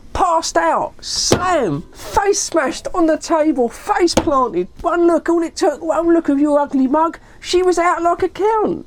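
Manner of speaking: high-pitched ranting, without shouting; retold outraged rant, punching each phrase; worked up. Dave describing the girl's collapse to his friend, worked up. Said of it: "He was so worked up".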